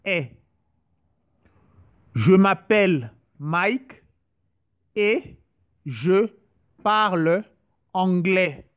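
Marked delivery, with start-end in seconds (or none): 2.1-8.6 each word set apart with pauses. Shown as a demonstration. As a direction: break "Je m'appelle Mike et je parle anglais" into separate words, pausing after "et" and "je". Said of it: "just to, to practice".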